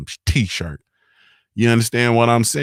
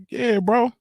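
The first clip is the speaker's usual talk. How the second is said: mock dry agreement; 14 semitones higher than usual. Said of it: "I'm like".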